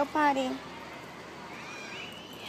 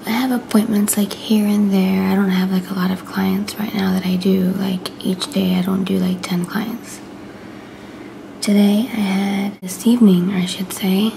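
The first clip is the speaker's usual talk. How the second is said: whispering; 7 dB louder than usual. Real, not acted. Whispers to camera throughout.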